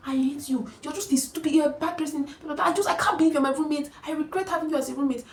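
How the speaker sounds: high, ranting mock tirade; imitated furious outburst, pitched high; angry shouting. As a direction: go full-on mad at a roommate. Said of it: "She just started shouting".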